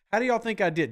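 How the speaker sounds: normal talking voice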